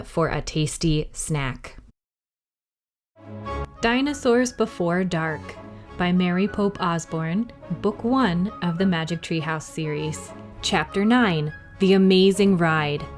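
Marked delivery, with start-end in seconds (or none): none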